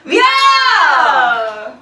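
loud drawn-out cheer; toast shout. Real, not acted. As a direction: yell the toast to the room.

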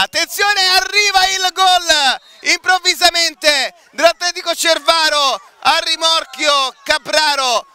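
high urgent shout, then rushing on; sudden surge at a surprise goal; goal call. Real, not acted. Sudden goal by away team.

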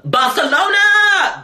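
high, slow, loud call of "Barcelona"; excited call. Real, not acted. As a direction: shout the city's name to the sky. Calling out to city.

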